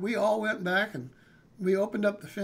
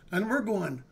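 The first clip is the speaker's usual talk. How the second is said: ordinary talk, nothing marked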